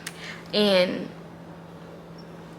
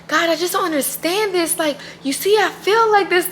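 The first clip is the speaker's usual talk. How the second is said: high, pleading retelling of an upset prayer; retold angry plea; 9 semitones higher than usual. Retelling her angry prayer to God, high and pleading. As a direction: replay the frustrated plea, pitched high. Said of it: "I was just so angry".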